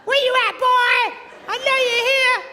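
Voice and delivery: high, loud mock wife calling; Eve calling through jungle. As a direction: call out for Adam like a frantic wife. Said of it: "she didn't have to go running through the jungle going".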